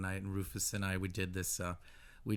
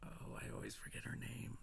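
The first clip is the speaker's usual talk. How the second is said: unvoiced, much softer aside; whispered aside; 13 dB quieter than usual. Real, not acted. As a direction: whisper it to yourself.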